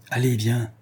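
ordinary delivery, nothing marked

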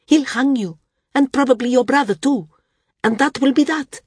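high-pitched, emphatic protest; demanding. A narrator's reading. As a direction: demand that they see sense. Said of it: "Srella demanded".

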